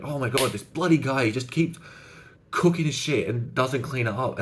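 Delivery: exasperated rant. Retold or imitated. Guy venting to a new friend about roommate's dishes, mildly exasperated. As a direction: vent about the messy roommate, lightly played. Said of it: "he's really passionate".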